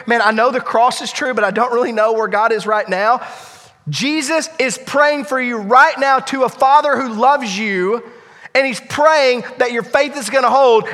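loud, high, rousing encouragement; preaching at full force. Acted. Pastor encourages struggling church members, loud and high. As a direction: lift them up with conviction.

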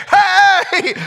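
yell. Retold or imitated. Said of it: "the yell comes again".

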